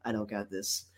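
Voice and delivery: low, very soft admission; small, hushed retraction; deflated Daphne. Retold or imitated. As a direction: drop the voice right down, confidence gone. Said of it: "She goes".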